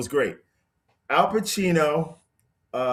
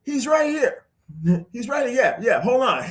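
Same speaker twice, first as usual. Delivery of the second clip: higher, eager phone chatter; excited phone call; 9 semitones higher than usual. Retold or imitated. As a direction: tell a friend on the phone excitedly. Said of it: "he calls him up and he says".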